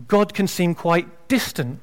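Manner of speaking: usual speaking voice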